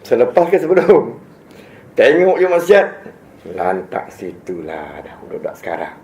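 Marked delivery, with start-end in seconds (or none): 3.5-6.0 low, soft, slow and weary as if nobody cares; a lazy, can't-be-bothered mutter; dismissive shrug. Retold or imitated. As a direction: brush it off like it's not your business. Voicing an indifferent bystander.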